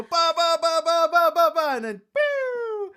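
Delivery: high, loud pumping noises; sound effects. Retold or imitated. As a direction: vocalise the machine pumping. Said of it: "and you go".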